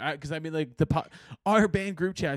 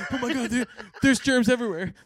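laughing; 7 semitones higher than usual. Real, not acted. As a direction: laugh through a mock panic. Laughing mock panic.

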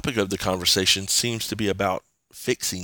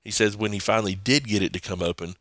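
normal talking voice

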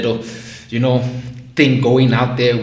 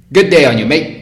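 loud, higher mock-Australian greeting; unsure, playful stab at Australian; attempted Australian accent; 4 semitones higher, 7 dB louder than usual. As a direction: try on a hammed-up Aussie accent. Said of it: "I don't know if that's the accent".

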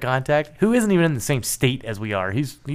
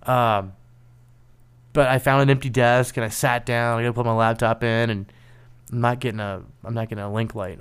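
ordinary talk

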